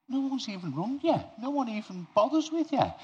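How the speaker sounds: high, incredulous disbelief; aghast. Retold or imitated. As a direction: sound shocked that nobody called. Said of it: "absolutely aghast".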